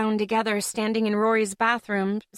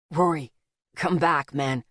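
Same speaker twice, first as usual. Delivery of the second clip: rasping; 6 semitones lower than usual. A narrator's reading. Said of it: "Zeke rasped".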